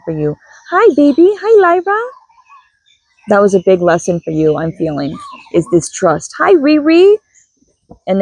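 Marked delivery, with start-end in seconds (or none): 0.7-2.0 high sing-song pet greeting. Real, not acted. Greeting her pet Lyra mid-reading in high baby talk. 6.4-7.2 high sing-song pet greeting. Real, not acted. Greeting her pet Lyra mid-reading in high baby talk.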